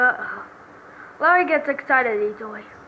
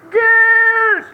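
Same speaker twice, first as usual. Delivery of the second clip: high, loud, drawn-out cry; shocked outburst; 10 semitones higher, 11 dB louder than usual. Acted. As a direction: wail it in disbelief. Defendant shocked by the revelation.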